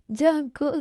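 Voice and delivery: tearful call of his name; acted weepy voice; crying. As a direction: say it through sobs. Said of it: "क्यों रो रही हो".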